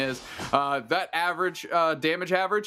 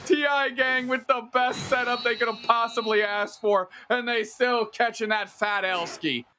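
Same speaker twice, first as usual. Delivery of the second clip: dramatic lament; 7 semitones higher than usual. Real, not acted. Mock-dramatic lament after upset.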